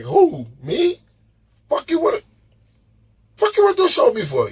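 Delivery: high-pitched mock disbelief; incredulous. Stunned guest answering a friend's show offer, incredulous. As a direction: squeak it out, baffled by the offer.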